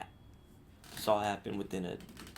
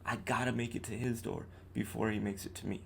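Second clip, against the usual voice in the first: usual speaking voice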